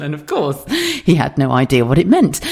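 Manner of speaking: ordinary talk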